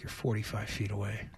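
dry deadpan golf snob; lightly voiced golf snob; low snobby retort. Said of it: "Hardy's like".

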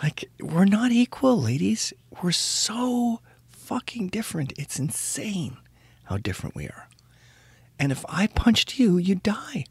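conspiratorial mock whisper; hushed, breathy confiding aside. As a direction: lean in and whisper it like a secret. Aside mocking women, whispered.

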